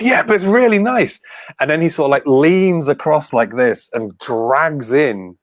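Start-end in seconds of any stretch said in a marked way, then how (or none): 0.0-1.2 imitating the host, high and louder. Host praising the game, high and bright. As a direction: chirp it like an eager presenter. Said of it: "He goes".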